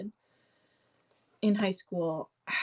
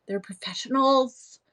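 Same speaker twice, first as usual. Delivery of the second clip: whisper; 4 semitones higher, 6 dB louder than usual. Real, not acted. Sarcastic aside about actors.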